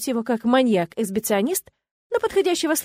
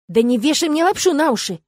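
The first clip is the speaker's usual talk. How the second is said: loud, higher-pitched indignation; outraged objection, voice raised; indignant; 5 semitones higher, 6 dB louder than usual. A narrator's reading. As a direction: protest as if refusing to be fooled. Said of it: "возмутилась я".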